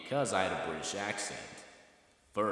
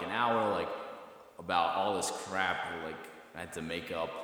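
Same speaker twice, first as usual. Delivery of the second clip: usual speaking voice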